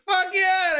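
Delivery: high, whooping cheer; retold hysterical glee. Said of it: "I was laughing hysterically".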